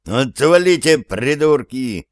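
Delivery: normal talking voice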